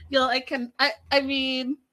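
usual speaking voice

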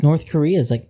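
normal talking voice

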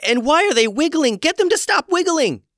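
high, loud frantic shriek; panicked yelling. A narrator's reading. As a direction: freak out at wiggling toes. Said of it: "yelled Gwen".

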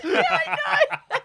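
high, loud laughter through words; cracking up while answering; laughing. Real, not acted. Laughing hard.

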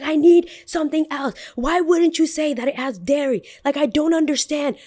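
high-pitched irate customer imitation; mock angry demand. Customer throwing his drink at barista, mock irate. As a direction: act out a heated, indignant tirade.

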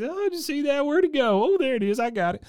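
very high, giddy mock squeal; giggly Bill. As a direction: imitate gleeful play in a falsetto. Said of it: "he's just laughing and giggling".

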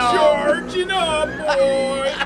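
high, loud straining anime power-up scream; powering-up yell. Acted. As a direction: charge up like a Saiyan, screaming high. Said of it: "Okay, start to yell".

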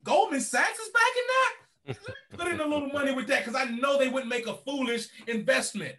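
eager investor. Retold or imitated. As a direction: play the investor suddenly eager to buy in. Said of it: "And so now somebody says".

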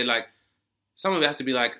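ordinary delivery

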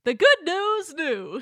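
slow booming show intro; drawn-out TV host; announcer voice. Retold or imitated. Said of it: "you can hear Dad saying".

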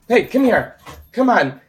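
high pet-calling voice; coaxing call to pet. Real, not acted. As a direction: call the dog over.

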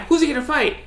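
imitating an announcer climbing to a peak. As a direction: push it up another notch of excitement. Mimicking hyped fight announcers.

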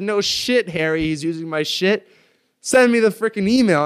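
loud, high-pitched angry retort; going ballistic. Retold or imitated. Replying to friend who flagged the email, ballistic outburst. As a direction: re-enact blowing up, shouting high and hard. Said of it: "and I go ballistic".

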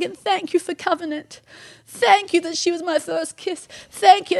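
high, moved prayer; fervent thanks. Retold or imitated. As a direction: send each thanks upward in a high voice. Husband's prayer of thanks, kneeling.

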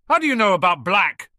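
loud, high-pitched demand. A narrator's reading. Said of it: "Hagrid demanded".

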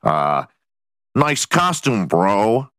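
sneering mock nerd, lightly voiced; lightly voiced smug nerd mocking a costume; snide nerd. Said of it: "some nerd being like".

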